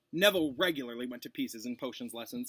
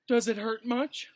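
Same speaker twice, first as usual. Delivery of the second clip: higher, louder, fawning concern; simpering; 9 semitones higher, 5 dB louder than usual. Acted. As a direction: gush with fake sympathy. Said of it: "simpered Pansy Parkinson".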